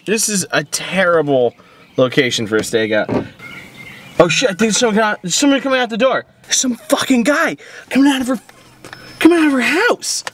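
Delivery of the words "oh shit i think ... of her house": high, fast alarm; rising panic as he spots him; panicked excitement. Acted. Spots a man at door.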